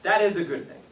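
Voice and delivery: ordinary delivery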